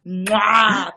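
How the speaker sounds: big loud kiss sound; drawn-out "mwah"; blown kiss. Real, not acted. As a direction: blow an exaggerated kiss to the fans. Blows a kiss to viewers.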